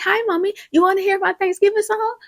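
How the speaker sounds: put-on high little-girl voice, eager; Olivia. Little girl greets her mommy on the phone, childlike. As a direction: imitate a small child chirping to mommy. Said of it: "And she's like".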